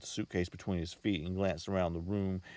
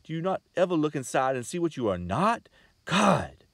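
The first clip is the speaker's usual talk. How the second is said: loud, high outburst; woman bursting out at her mother, shouting; cried out; 9 semitones higher, 8 dB louder than usual. A narrator's reading. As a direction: cry it out at the table, half choking. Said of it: "she had cried".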